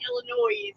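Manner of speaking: "Illinois" with the final S sounded; mispronounced Illinois. Shown as a demonstration. Cohost airing a pet peeve, mispronounced Illinois. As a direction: say "Illinois" ending in a noise sound. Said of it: "my biggest pet peeve".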